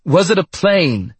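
high, urgent repeated yell; high-pitched, insistent shouted question; excited yell. A narrator's reading. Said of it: "Doctor Zade yelled".

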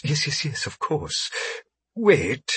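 soft fawning alien voice; smooth-talking alien merchant; ingratiating alien trader. A narrator's reading. Alien agrees then pauses.

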